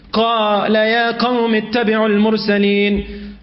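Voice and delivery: quick chanted verse; Quran recitation. Acted. Preacher quotes Surah Yasin to the congregation, chanted. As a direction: recite it in rapid cantillation.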